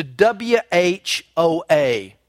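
each letter W H O A said apart; slow spelling of W H O A; spelled out slowly. Shown as a demonstration. Pastor slowly spelling out the word for the congregation. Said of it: "he changes its spelling".

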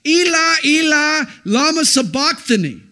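anguished cry, loud and high; a loud, anguished cry to God from the cross; agonizing cry. Acted. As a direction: cry out in agony to heaven. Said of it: "Jesus' agonizing cry from the cross".

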